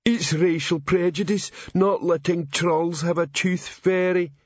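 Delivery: sulky troll grumble; a hint of a sulky troll; muttered. A narrator's reading. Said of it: "Clinkerbell muttered".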